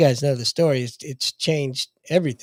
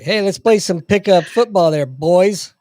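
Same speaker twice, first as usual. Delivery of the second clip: hearty call; 5 semitones higher, 10 dB louder than usual. Pretend. Imagined call to Afghan boys.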